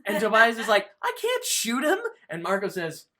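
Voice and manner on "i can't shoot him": very high-pitched mock protest. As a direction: voice jumps way up in disbelief, imitating. Tobias protests order to shoot.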